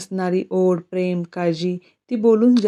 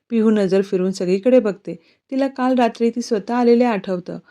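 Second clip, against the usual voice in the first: ordinary delivery, nothing marked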